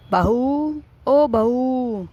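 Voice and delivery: drawn-out summons to daughter-in-law; calling out. Acted. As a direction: call her from another room, stretching the word. Mother-in-law calls out for daughter-in-law. (ज़ोर से लंबी पुकार)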